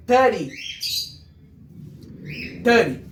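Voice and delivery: thirty with the t said almost like an R. Shown as a demonstration. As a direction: tap the middle of thirty. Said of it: "meio com um R". (thirty com o T soando meio como R)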